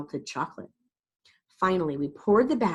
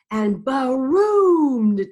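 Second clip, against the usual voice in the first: slow booming thunder; 6 semitones higher, 8 dB louder, much slower than usual. A narrator's reading. Thunder sound effect.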